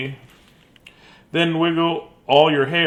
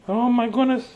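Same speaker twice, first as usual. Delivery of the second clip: ordinary delivery, nothing marked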